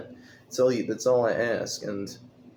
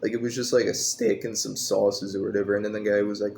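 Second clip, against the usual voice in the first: ordinary delivery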